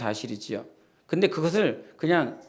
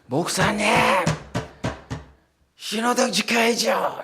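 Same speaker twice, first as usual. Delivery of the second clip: calling out; 8 semitones higher than usual. Retold or imitated. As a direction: shout a scolding reminder after him. Fellow pastor calling out at crosswalk. (신호를 무시한 목사를 뒤에서 부르는 동료 목사, 높고 큰 외침)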